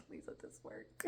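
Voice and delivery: soft, desperate whisper; whispered plea. Retold or imitated. Woman retelling her prayer over the syringe, whispered plea. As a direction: plead under your breath.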